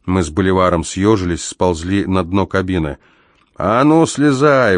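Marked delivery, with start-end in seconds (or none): 3.6-4.8 higher-pitched, drawn-out order; imperious growled command from the police. A narrator's reading. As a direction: order them down with authority. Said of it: "властно прорычали снизу".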